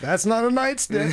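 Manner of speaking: laughing high and loud. Pretend. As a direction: squeal it through a laugh. Joking cop roleplay, laughing.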